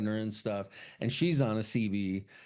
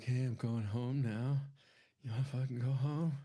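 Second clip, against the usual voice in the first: mock soft come-on; 5 dB quieter than usual.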